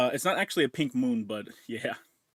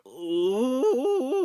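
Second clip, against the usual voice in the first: high ooh; 12 semitones higher, 4 dB louder than usual. Real, not acted. Reacting to own chewing sound.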